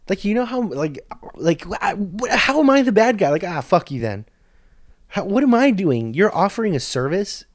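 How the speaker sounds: real worked-up anger at the quarter guy; fired-up rant. Real, not acted. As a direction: vent like the wrong is fresh. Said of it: "I'm getting fired up just talking about it".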